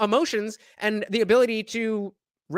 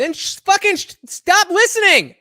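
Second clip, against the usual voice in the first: exasperated shout; 11 semitones higher, 9 dB louder than usual. Real, not acted. Reacting to the streamer, a loud exasperated shout. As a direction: blow up, fed up with her complaining.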